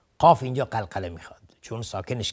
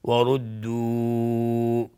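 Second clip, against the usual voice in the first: very slow recitation, final vowel held long; Quran recitation; 5 dB louder, much slower than usual. Shown as a demonstration. Teacher demonstrating the long madd, drawn-out recitation. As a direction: stretch the last vowel as in recitation. Recites the word being taught.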